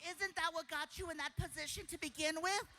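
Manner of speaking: high, put-on critic's voice; sceptical audience member. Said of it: "Some of you are like".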